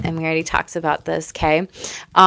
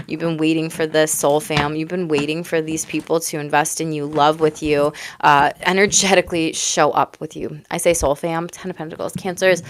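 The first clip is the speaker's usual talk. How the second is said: ordinary talk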